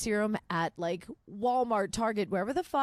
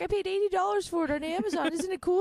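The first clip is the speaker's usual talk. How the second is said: much higher, put-on bragging voice; mock smug hipster; 12 semitones higher than usual. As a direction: show off a pricey relic like a snob. Mocking eight-track hipster.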